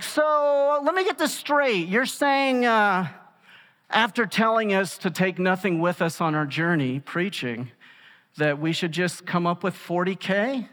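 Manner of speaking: sarcastic disbelief. Retold or imitated. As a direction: let me get this straight, dripping sarcasm. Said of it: "If you think that's sarcasm".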